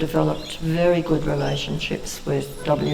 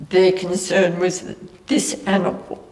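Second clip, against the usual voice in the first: fighting back tears; teary; 4 semitones higher than usual. Real, not acted. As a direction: voice cracking high with tears. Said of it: "sorry, I get a bit teary about this".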